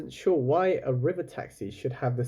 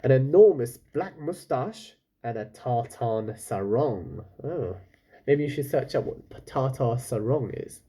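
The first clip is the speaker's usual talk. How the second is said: ordinary delivery, nothing marked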